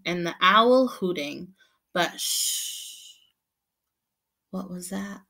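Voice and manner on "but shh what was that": soft hissing shh; shushing. A narrator's reading. Narrator hushing children for the next noise, soft. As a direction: hush the listeners.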